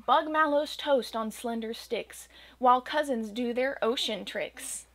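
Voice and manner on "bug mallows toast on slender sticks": ordinary delivery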